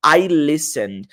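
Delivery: I listened with a subtle final D; slow demo. Shown as a demonstration. Teacher slowly modeling I listened. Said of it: "pronunciar o D ali no final de forma bem sutil".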